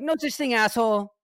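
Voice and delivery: high, loud mock tough-guy jab. Friend jokes he'll walk by sneering, mock tough guy. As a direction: snap it like a cocky passerby. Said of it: "I'm gonna walk by you like".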